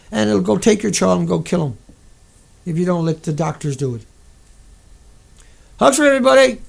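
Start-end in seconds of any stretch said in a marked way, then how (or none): 5.8-6.6 louder, higher call-out; cheery sign-off. Real, not acted. Streamer signing off to viewers, loud cheerful call-out. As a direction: beam it out to the chat.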